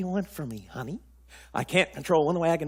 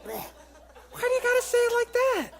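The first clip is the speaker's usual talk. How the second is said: whiny protest; 3 dB louder than usual. Acted. Husband protests wife's crude words.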